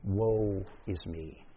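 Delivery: low, slow, hushed despair. Acted. Preacher showing that woe conveys despair, quiet and despairing. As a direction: sink into a soft, hopeless lament. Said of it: "it conveys the notion of despair".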